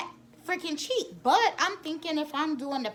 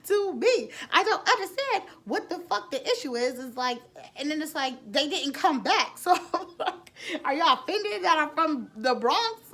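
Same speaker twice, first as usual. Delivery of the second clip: higher, laughing through it; laughing disbelief; 4 semitones higher, 4 dB louder than usual. Real, not acted. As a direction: giggle at how absurd it was.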